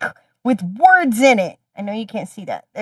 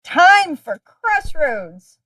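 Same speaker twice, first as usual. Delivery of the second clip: slow, higher, drawn-out segment call; announcer; 6 semitones higher, much slower than usual. Acted. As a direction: announce it like a show host. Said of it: "segment announcement".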